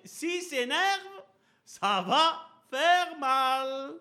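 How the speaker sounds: high, slow, drawn-out mock warning; mother's warning. Mother warning her children before papa comes home, high and drawn out. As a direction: play the mother scaring the kids, stretched and loud.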